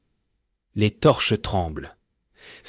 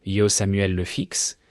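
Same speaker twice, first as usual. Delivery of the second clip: ordinary delivery, nothing marked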